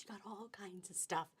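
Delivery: softly whispered aside. Real, not acted. Whispering to viewers about the space print. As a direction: drop to a whisper.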